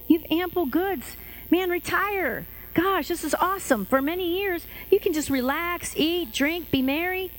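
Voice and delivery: high-pitched, delighted rich man; mock self-satisfied glee. Rich man tells his soul to retire, mock elated. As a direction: congratulate yourself in a lifted, gleeful voice.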